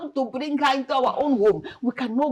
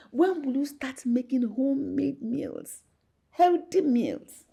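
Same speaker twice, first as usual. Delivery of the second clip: drawn-out demand; 6 semitones higher, slower than usual. Acted. Mother demanding better food.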